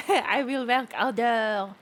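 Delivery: loud, slow, hammed-up French accent; overdone French accent, every word stretched. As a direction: blare it out in a mock French accent. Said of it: "Yes, that's how French works".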